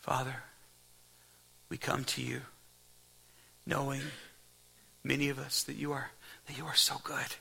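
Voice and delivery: soft, quiet pastoral prayer; hushed closing prayer over the church. Real, not acted. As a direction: pray it quietly to God.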